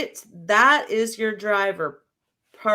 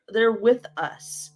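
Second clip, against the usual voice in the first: usual speaking voice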